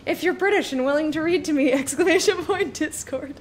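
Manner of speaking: loud, high-pitched excited call-out; playful high, loud announcement; excited mock plea. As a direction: call out an eager invitation. Mock plea for British readers.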